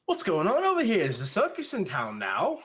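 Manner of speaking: high, loud villager gasping at the intruders; a villager blurting out in alarm; startled villager. Acted. Villager startled by the ogre, high and loud. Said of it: "and they go".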